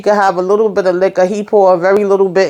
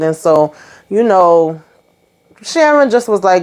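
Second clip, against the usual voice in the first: usual speaking voice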